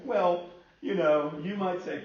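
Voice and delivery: high and loud, still laughing. Real, not acted. Pastor still laughing as he talks to the church. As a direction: let the laugh carry into the words.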